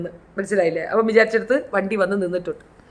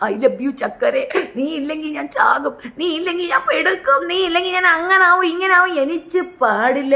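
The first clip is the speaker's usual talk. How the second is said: mock lovesick plea; 4 semitones higher than usual. Lover begging on his knees.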